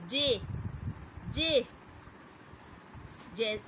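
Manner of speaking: जे said clearly, again and again; repeating the letter. Shown as a demonstration. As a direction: drill जे for the class. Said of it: "निकलती है". (जे का बार बार उच्चारण)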